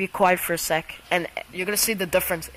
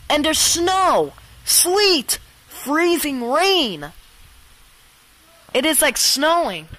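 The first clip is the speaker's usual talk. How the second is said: excited disbelief; 6 semitones higher, 4 dB louder, slower than usual. Real, not acted. Disbelief at spring snow.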